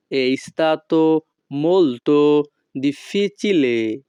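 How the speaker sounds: each Italian word spaced out very slowly; slow teaching model. Shown as a demonstration. Teacher models Italian phrase for students, very slow. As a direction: spell it out slowly for learners to copy. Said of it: "তখন আমাদেরকে বলতে হবে".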